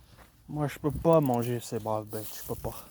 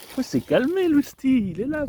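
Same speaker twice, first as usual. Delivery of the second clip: high pet-talk voice; 11 semitones higher, 6 dB louder than usual. Real, not acted. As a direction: coo up high at the animal. Calming the animal.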